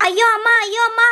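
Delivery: yelping as her hair is pulled; pained cry. Acted. Girl yelps as Nancy pulls her hair. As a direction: cry out at a sudden tug.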